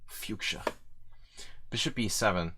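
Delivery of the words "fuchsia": Fuchsia whispered, with a "fyook-sha" sound. Shown as a demonstration. Streamer testing the word Fuchsia, whispered. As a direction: breathe Fuchsia out unvoiced, trying the word.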